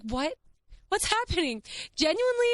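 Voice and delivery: very high incredulous squeal; overwhelmed disbelief. Real, not acted. Vlogger addressing viewers about the bag charm, overwhelmed disbelief. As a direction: let the voice shoot up in amazement.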